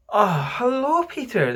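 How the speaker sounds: high, lifted hello; high-pitched, pleased "ah, hello"; relieved greeting. A narrator's reading. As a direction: greet an old friend with great relief. Boys greet Peter with relief.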